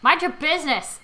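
loud mock indignation; shout. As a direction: bark it back defensively. Snaps at nosy passerby.

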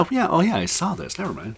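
high put-on voice of recognition; mock realization, pitched high; remembering viewer. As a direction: act out it all coming back. Voicing viewer remembering the movie.

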